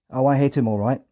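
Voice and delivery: ordinary talk, nothing marked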